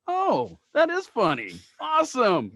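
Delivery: high, mock-delighted reply; dry mock delight, high and bright; sarcastic.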